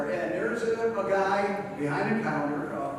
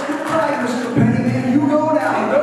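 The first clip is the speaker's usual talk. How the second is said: higher, louder mock yell shooing someone out; clerk's retold shout, higher and louder; 7 semitones higher, 8 dB louder than usual. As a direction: shoo them out with a hammed-up yell. Clerk throws them out.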